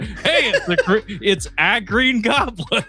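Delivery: high mock-dad voice breaking into laughter; laughing dad imitation. Host mimics his dad spotting the Goblin, laughing high. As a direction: laugh through the dad impression.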